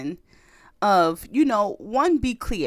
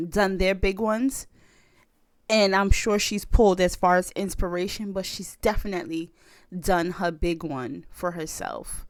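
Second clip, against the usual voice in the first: ordinary talk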